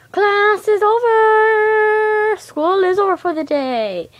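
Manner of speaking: slow, high, loud class-is-over call; teacher announcement. Pretend. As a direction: announce the end of school.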